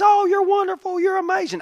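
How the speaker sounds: high, gushing mock praise; mock flattery. Preacher mimics the crowd flattering him, high gushing. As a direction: fawn over him in a sing-song. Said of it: "I know everybody says".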